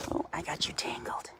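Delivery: soft whispered apology; hushed apology to the tangled raven. Real, not acted. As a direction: murmur it under your breath. Whispering while untangling the bird.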